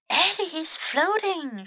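high, soft aside; saying to oneself. Acted. Teacher looking closer at the new student, talking to herself. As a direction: voice it softly, as a private observation. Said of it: "she said to herself".

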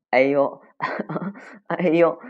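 high, loud ouches broken by a laugh. Acted. 可可 rubbing the sore bottom, acted laughing ouch. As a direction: groan in pain, then giggle through it. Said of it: "他笑了".

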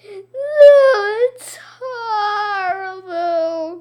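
high, slow theatrical sob; milk the tears; hammed-up crying. A narrator's reading. Teacher wailing to the kids, slow hammed-up sob. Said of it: "Missus Rupee cried".

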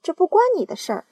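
fast, high, loud snap; aunt snapping at James, fast and loud; snapping retort. Acted. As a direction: cut him off sharply. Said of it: "抢白道".

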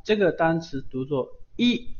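ordinary talk, nothing marked

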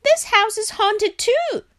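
high, alarmed Frog cry; frightened shout. A narrator's reading. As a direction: cry out high-pitched in panic. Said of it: "shouted Frog".

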